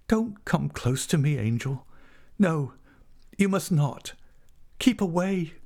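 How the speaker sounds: high-pitched, urgent woman's voice; agitated heroine, high and insistent; distressed woman warding him off. A narrator's reading. Tess warding off Angel in story.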